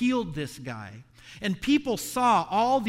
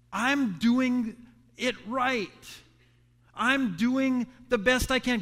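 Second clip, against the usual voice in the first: higher, aggrieved plea that slows at the end; pleading complaint; 5 semitones higher, slower than usual. Pretend. Believer questioning God about suffering, higher and aggrieved. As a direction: plead with God like you've been wronged. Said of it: "question God to say".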